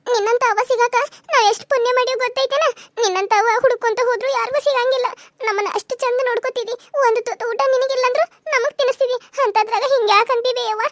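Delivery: put-on high, emotional child's plea; earnest high-pitched reassurance; tearful pleading. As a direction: console mother while holding back tears. Child consoles weeping mother.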